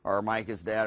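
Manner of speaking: low, soft, unimpressed relay; casual everyday answer. Retold or imitated. Relative handing the phone over for an ordinary call, offhand. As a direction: pass it on as no big deal. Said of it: "they just say".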